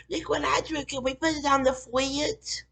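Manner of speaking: put-on high, slow childish voice; mock little kid. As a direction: mimic a proud little kid showing off a drawing. Mocking Skanka showing her drawing.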